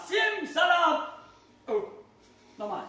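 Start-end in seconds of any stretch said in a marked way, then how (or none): 0.1-1.1 loud, ringing magic spell; kids party magician. Acted. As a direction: call out the magic word like a showman.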